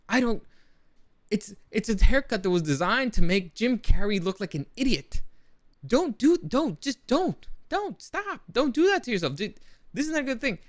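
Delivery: high, loud pleading rant against a haircut; exasperated rant. Real, not acted. Vlogger begs viewers to drop the haircut, exasperated and loud. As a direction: beg them to stop, exasperated and loud.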